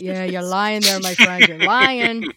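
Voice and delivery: loud, playful call-out; teasingly loud and emphatic; mock accusation. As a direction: call their bluff at full volume. Playfully calling out the false denial.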